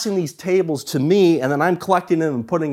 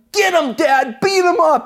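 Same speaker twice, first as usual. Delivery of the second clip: very high, loud shouted cheer; kid cheering dad on; 12 semitones higher, 5 dB louder than usual. Retold or imitated. Boy urges his dad after the kids, high loud shout. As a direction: yell it like a boy egging on his hero. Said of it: "I'm like".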